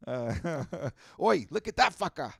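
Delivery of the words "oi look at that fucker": loud, high Australian shout; Aussie Man. Acted. Imitating a YouTube commentator, loud rowdy Australian. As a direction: holler it in a broad Australian accent. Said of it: "does the voice of them".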